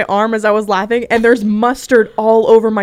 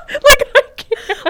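helpless high, drawn-out laughing words; real laughing; 7 dB louder, slower than usual. Real, not acted. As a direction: squeeze the words out through laughter. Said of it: "we're laughing about flipping Debbie Ryan".

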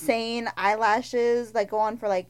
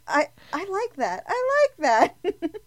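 higher, rising squeal of approval; delighted reaction; 8 semitones higher, 3 dB louder than usual. Retold or imitated. Telling the co-host about a favorite line, delighted. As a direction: gush over it, climbing higher.

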